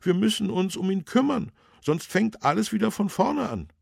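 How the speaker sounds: high, loud, disapproving official; councillor character. A narrator's reading. Councillor corrects the policeman, high and firm. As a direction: object in a voice pitched well up.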